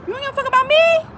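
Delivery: high, loud accusing question; angry challenge. Real, not acted. Confronting people who wish her harm, high loud accusation.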